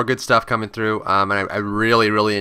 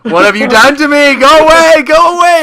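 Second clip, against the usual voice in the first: much higher, louder pleading go away; hammed-up scream at your teammate to leave; mock panicked yelling; 13 dB louder than usual. Player yelling at teammate to stay away, hammed-up panic.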